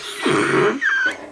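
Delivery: high, wheezy giggle fit; laughing. Real, not acted. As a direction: burst out laughing at yourself. Laughing at own bad voice.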